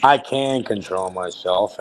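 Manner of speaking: slow, low, deliberate calm; calm and controlled. Shown as a demonstration. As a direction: show how composed you are. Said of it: "and I can talk that way too".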